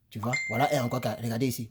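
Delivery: ordinary talk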